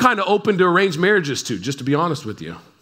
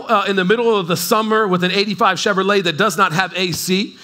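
ordinary talk

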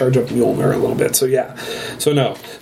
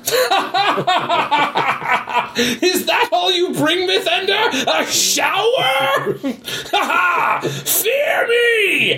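high, laughing mock-godly taunt; booming god voice; much slower than usual. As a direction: taunt like a cocky thunder god. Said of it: "and he simply goes".

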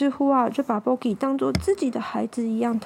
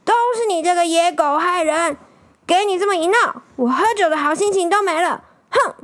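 raised, higher voice, grumbling villain; loud angry scolding; 4 semitones higher, 4 dB louder than usual. Acted. Mean old man shouts at the dog in the rain, angry scolding. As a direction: rail at the dog in a huff. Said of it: "大声骂".

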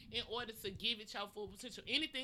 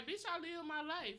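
sassy; 3 semitones higher, 11 dB quieter, slower than usual. Retold or imitated. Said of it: "didn't wanna be rude and be like".

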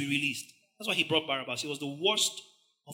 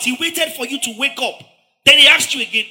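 usual speaking voice

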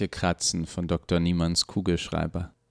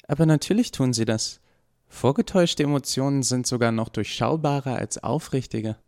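put-on higher young voice, matter-of-fact; puzzled Eva; 7 semitones higher than usual. A narrator's reading. Eva contradicts the doctor about emotions, confident higher voice. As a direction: say it as Eva, sure of herself.

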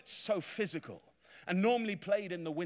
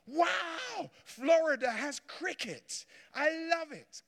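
excited delight; 9 semitones higher, 3 dB louder, slower than usual. Retold or imitated. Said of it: "And I thought".

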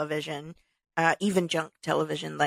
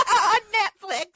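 high, loud, laugh-filled voice; 5 dB louder, slower than usual. Real, not acted. Admitting her Netflix murder viewing, laughing. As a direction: squeeze the words out through laughter.

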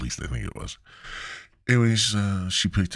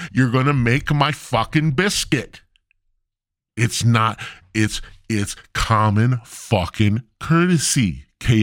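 angry rant; 5 semitones higher than usual. Real, not acted. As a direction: lecture the restaurant furiously. Ranting at KFC.

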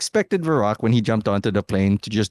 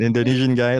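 ordinary talk, nothing marked

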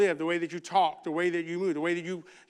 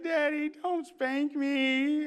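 high, drawn-out plea to Daddy; whiny child's plea, pitched way up; pleading little daughter; 9 semitones higher, much slower than usual. Retold or imitated. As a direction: beg like a small kid, high and slow. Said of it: "she was like".

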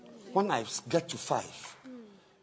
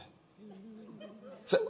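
usual speaking voice

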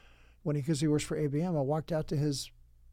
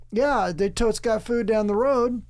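higher, louder friendly lady; sweet lady voice; 5 semitones higher, 5 dB louder than usual. Retold or imitated. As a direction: chirp it like a sweet helpful clerk. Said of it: "She's like".

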